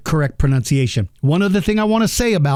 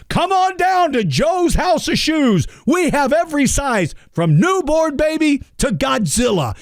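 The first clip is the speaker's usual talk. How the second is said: mock shouted shoe store ad; cheesy ad announcer; 9 semitones higher than usual.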